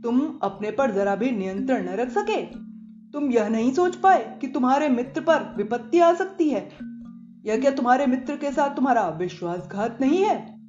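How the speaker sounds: reproachful questioning in a put-on voice; heated scolding, building in the questions; agitated. A narrator's reading. Bhaskar confronts Kant over the betrayal, agitated. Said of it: "Bhaskar ने उत्तेजित होते हुए पूछा".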